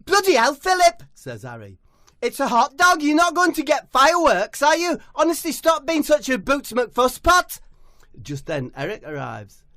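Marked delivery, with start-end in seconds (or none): 0.1-1.0 high, loud exasperated outburst; high loud exasperated footballer; exasperated shout. Acted. Harry exasperated. 2.2-7.6 high, loud telling-off; high loud mock scolding; high exasperated scolding. Harry scolding Philip.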